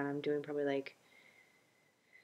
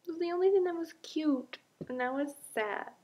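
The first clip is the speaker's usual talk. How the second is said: mock pouty lament; 14 semitones higher than usual. Mourning her vanished butt, high hammed-up pouty whine. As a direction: whine like a sulking child over a lost toy.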